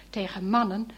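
ordinary delivery, nothing marked

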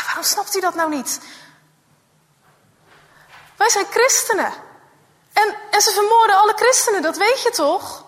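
put-on upset girl. Girl insists to her friend they will be killed. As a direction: make him understand, urgently. Sarah insists they're Christians.

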